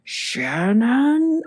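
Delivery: high, drawn-out call with a rising end; stretched-out mock booming dad call; Eric's booming voice. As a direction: imitate a dad calling out for his child. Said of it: "in his very Eric booming voice".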